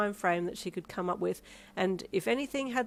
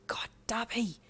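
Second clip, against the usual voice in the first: breathy, drawn-out scolding hiss; exasperated hiss; 4 semitones higher than usual. A narrator's reading. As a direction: hiss at your husband, mortified. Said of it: "you hissed at him".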